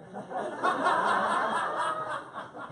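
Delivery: high burst of laughter; loud laugh. Real, not acted. Big laugh at the joke.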